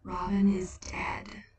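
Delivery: slow whispered sorrow; slow whisper of Death; Death's hushed voice. Acted. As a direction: breathe out the terrible news gently. Death delivers the news.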